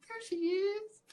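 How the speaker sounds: put-on high child voice. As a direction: pipe up like a tiny kid. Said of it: "And she's like".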